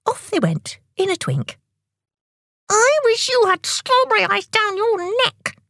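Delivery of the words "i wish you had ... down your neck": very high, squealed angry wish; angry shout. A narrator's reading. Tick getting back at Tock, angry high-pitched shout. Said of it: "Tick shouted angrily".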